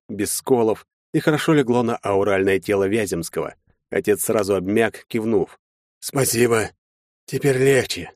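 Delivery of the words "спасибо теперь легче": halting, ailing voice; faint, effortful thanks; weak relief. A narrator's reading. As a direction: speak with little strength left. Father slackens, thanks weakly.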